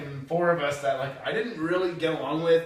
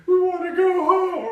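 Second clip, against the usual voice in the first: much higher, slow whiny plea; mock whine; 7 dB louder than usual. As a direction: mimic the stranded friends whining. Mocking the broke friends.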